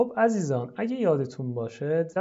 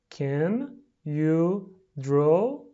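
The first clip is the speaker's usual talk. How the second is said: very slow, each word separate and rising; 3 dB louder, much slower than usual. Shown as a demonstration. Teacher pronouncing for pupils at home, word by word. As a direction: stretch out every word with a gap after it.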